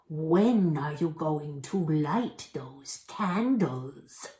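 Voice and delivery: an unvoiced, slow, creepy whisper; goblin whisper. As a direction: hiss the question like a lurking goblin. Goblin asks about candles.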